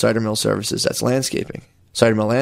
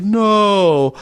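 mock pleading; 9 semitones higher, much slower than usual. Fake-pleading with the collector not to come, high and hammed-up. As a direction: beg in a hammed-up panic. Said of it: "I was like".

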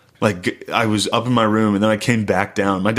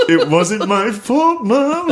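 slow, high, climbing plea to mom; mock whining excuse; 9 semitones higher, much slower than usual. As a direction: whine your innocence, half laughing. Retold whining excuse to his mother.

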